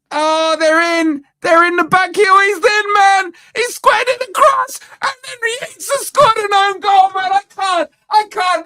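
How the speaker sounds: high, frantic disbelief climbing as the goal goes in; a fan watching his team concede, shouting in panic; panicked rising yell. Real, not acted. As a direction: scream the goal in horror as it happens.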